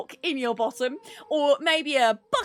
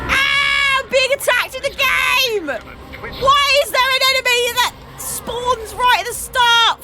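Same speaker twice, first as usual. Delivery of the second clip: high frantic exclamation; panicked at a sudden enemy; alarmed shouting; 11 semitones higher, 9 dB louder, slower than usual. Real, not acted. Real alarm at in-game enemy.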